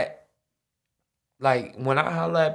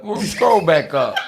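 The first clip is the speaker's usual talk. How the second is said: laughing; 4 semitones higher, 7 dB louder than usual. Real, not acted. Reacting to shocking meme.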